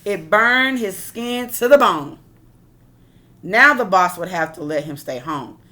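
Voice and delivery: normal talking voice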